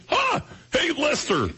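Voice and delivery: high, raspy alien puppet voice; squeaky alien puppet voice; Alf voice. Retold or imitated. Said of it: "Lee reminded me".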